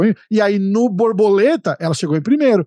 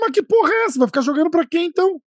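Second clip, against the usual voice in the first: exasperated outburst; 8 semitones higher, faster than usual. Retold or imitated. Father outraged nobody keeps score.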